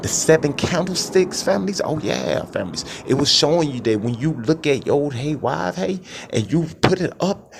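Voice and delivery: rising preacher fervor, peaking high; preaching at full force. Acted. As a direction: build the congregation up, voice rising line by line.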